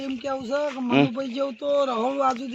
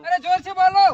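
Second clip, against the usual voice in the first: loud, raised-pitch urging; rousing shout; 8 semitones higher, 7 dB louder, faster than usual. Real, not acted. Leader urging pilgrims to chant louder, rousing shout. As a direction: shout to rally the group.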